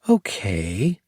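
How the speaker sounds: drawn-out okay. A narrator's reading. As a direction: stretch out "Okay" with a rising, expectant lilt. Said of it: "She drew out the word as if to say, "So?".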